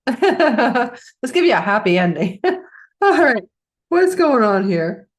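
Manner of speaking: giggling through the words; real laughter breaking through; laughing. Real, not acted. Laughing through a coughing fit.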